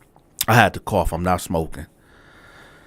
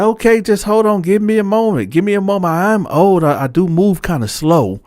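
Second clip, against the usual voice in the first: lightly voiced flustered old man, pitched higher; flustered cellmate; 9 semitones higher than usual. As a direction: play him caught out and apologizing, higher up. Old cellmate voiced asking for time.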